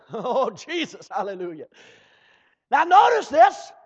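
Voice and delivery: normal talking voice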